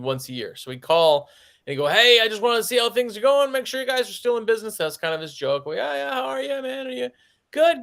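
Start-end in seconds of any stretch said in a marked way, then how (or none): 1.9-4.8 put-on high, chirpy old caller voice; old customer calling to check in; old man joking. 5.8-7.8 cheerful greeting. Retold or imitated. Own cheerful greeting, retold.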